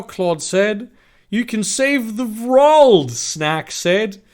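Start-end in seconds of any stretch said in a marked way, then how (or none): 1.3-3.1 slow, high, loud proclamation; acted rallying cry. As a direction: proclaim it grandly, pitched up. Ally cheers on the heroine.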